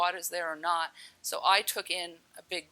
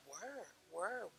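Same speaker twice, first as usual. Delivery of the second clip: very soft, questioning repeat; soft wondering echo; 12 dB quieter, slower than usual. Retold or imitated. Drowning woman echoing the cooing voice, very softly. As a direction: echo it hushed, ending on a rise.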